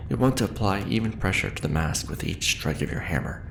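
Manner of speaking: ordinary delivery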